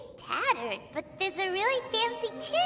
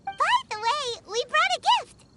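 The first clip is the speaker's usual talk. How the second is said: high, loud and chirpy; excited gift-bearer piping up; eager announcement; 7 semitones higher, 4 dB louder than usual. Acted. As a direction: blurt it out eagerly. Eager companion offers the noble a gift.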